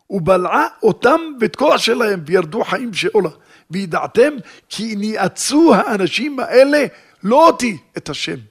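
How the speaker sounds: loud, high, declaiming preacher voice; forceful scripture reading. Acted. Preacher declaiming Moses' words and the verses, loud. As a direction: proclaim the verses to the congregation.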